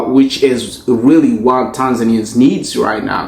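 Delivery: ordinary delivery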